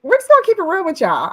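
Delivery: high, loud, fast burst; excited. Real, not acted. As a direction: hype up the audience. Energetic aside to audience.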